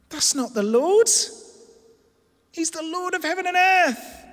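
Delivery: high, loud preaching climax; impassioned protest. Acted. Preacher as Paul corrects their idea of God, impassioned protest. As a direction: build to a soaring, forceful declaration.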